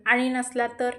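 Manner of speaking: ordinary delivery, nothing marked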